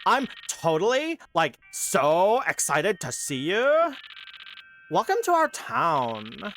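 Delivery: put-on slow bubbly valley girl voice; drawn-out valley girl lilt. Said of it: "I gotta go Valley Girl for this".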